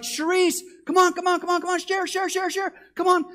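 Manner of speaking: high, loud repeated hollering; frantic calling. Acted. Husband calling his wife in from the storm, frantic calling. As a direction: call for her in a rush.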